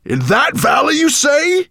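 high, loud roar of disbelief; roaring dragon. Acted. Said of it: "he roared".

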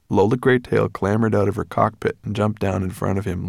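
ordinary delivery, nothing marked

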